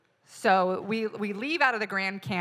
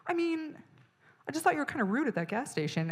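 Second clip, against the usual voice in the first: passive-aggressive; 8 semitones higher, faster than usual. Retold or imitated. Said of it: "somebody's like".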